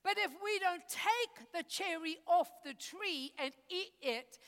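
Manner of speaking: usual speaking voice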